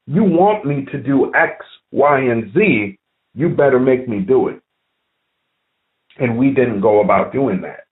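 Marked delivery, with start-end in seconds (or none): none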